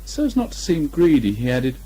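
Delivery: usual speaking voice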